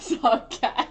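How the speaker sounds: loud, slowed by laughter; breaking into laughter. Real, not acted. Admitting to a partner the silly dog name, laughing. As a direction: let a laugh break up the words. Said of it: "I was laughing 'cause I".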